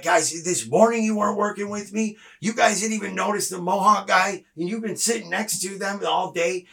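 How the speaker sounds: exasperated retelling of a telling-off; retold yelling. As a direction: tell them off in a mild mock yell. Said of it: "I'm yelling at them".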